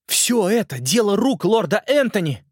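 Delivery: put-on a boy urgently pleading his case; earnest boy. Simon's lines in story.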